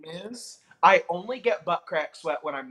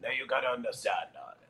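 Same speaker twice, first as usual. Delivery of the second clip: Tallulah Bankhead; 5 semitones lower, 6 dB quieter, faster than usual. Retold or imitated. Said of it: "You'd have Tallulah Bankhead saying".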